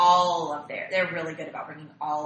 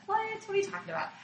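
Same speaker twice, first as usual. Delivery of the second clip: surprised; 14 semitones higher, 4 dB quieter, much faster than usual. Retold or imitated. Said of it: "And I was like".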